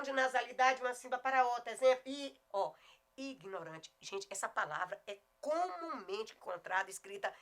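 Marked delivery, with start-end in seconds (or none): none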